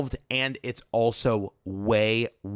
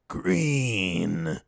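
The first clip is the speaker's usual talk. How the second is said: lightly imitated robotic voice, "Green." said flatly and lower; a hint of a creepy synthetic announcer saying "Green."; mock computer voice; 4 semitones lower than usual. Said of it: "in this horrible, distorted computer voice".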